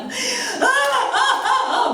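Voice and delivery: repeated high wailing cries; child's crying screams. Retold or imitated. The boy wailing in a tantrum, repeated high cries. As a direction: howl over and over like a tantrum. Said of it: "막 울면서 난리가 난 거예요".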